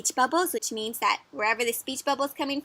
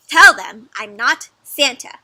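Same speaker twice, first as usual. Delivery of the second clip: slow, loud insistence; mildly exasperated bear giving orders; firm instruction; 12 dB louder, slower than usual. Acted. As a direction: stress each word emphatically. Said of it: "he tells his little family".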